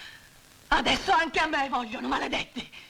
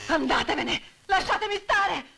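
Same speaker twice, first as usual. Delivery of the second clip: frightened shout; 7 semitones higher than usual. Acted. Frightened widow driving men off.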